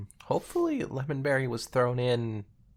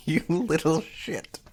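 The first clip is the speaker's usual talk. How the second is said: slow, amused mock insult; drawn-out playful jab; laughing tease; 9 semitones higher, 3 dB louder, slower than usual. Real, not acted. Teasing partner after laugh.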